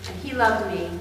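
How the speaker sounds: usual speaking voice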